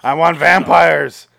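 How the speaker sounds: loud, slow, hammed-up demand; mock demanding shout. Acting out a Baldwin brother, a hammed-up loud demand. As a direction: bellow it like a spoiled actor.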